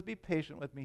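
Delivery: a hushed, understated plea; begging. A narrator's reading. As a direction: quietly beg the master for time. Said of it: "he begged".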